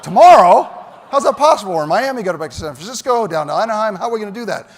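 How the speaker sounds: loud, high stunned question with a laugh; can't believe the timing; alarmed laughing disbelief; incredulous. Retold or imitated. Learns trip is tomorrow.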